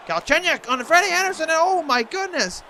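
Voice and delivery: high, loud excited call of the chance. Real, not acted. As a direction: yell it as the puck nearly goes in. Near miss in live hockey call.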